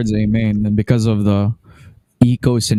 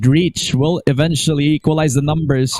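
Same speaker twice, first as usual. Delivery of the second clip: esports caster; 7 semitones higher than usual. Acted. Live play-by-play.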